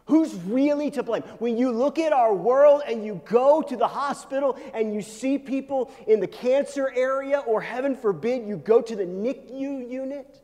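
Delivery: high, loud, driving sermon delivery; impassioned preaching. Real, not acted. Preacher confronts congregation with the world's suffering, impassioned preaching. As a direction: press the question on the congregation at full force.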